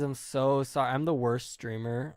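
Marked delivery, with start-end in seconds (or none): none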